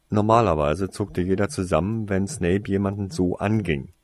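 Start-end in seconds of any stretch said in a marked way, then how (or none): none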